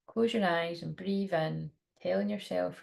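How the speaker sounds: slow soft meditation voice; guided meditation. Acted. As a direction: ease them into calm breathing. Leads a guided breathing meditation.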